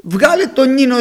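put-on stern order to a supposed drunk; scolding. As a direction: scold like an offended priest. Priest scolds woman he thinks drunk.

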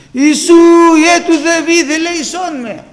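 loud, high pleading call; Βαρτίμιος crying out. Acted. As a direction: blind beggar calling out over a crowd. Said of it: "αρχίζει να φωνάζει".

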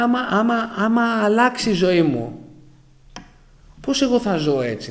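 high, loud, anxious pleading; panicked worry. Retold or imitated. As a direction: voice your fear of losing your life. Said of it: "Έλεγα".

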